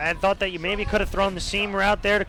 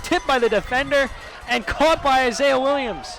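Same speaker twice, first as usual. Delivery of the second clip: loud, higher rapid recap; excited recap; 6 semitones higher, 4 dB louder than usual. Real, not acted. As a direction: relive the tip with energy. Analyst recapping tipped catch.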